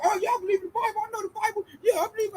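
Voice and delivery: high, mocking know-it-all voice; a high put-on brag about the Bible; mock boasting. Imitating a coworker bragging he knows the Bible, high mocking voice.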